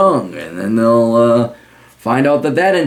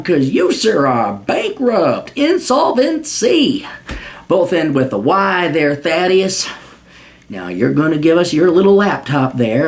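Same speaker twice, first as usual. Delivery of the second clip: cartoon southern lawman accent, stretching the words; 5 semitones higher than usual. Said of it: "really work on my southern accent".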